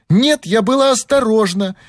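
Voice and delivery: put-on high-pitched tearful girl's protest; tearful cry. A narrator's reading. Girl defending herself against blame, tearful cry. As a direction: protest in a high, upset child's voice. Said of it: "крикнула со слезами девочка".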